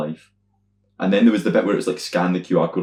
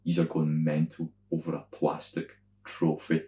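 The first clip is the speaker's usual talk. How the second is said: ordinary delivery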